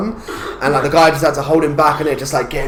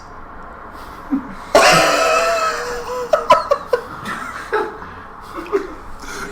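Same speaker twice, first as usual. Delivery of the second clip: long high breathless laugh; helpless high wheezing laugh; long wheezy laugh. Real, not acted. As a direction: laugh until the breath goes. Laughing at Bear Grylls video.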